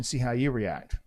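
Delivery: plain reading voice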